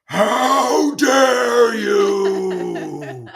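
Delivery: huge, high, very drawn-out outraged shout; rage. Retold or imitated. Pedestrian raging at a driver pushing into them, furious shout. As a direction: bellow it with theatrical fury, stretching every word. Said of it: "The rage that came out of me".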